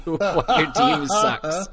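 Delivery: laughing while saying it. Real, not acted. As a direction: chuckle through the jab. Laughing at the coach.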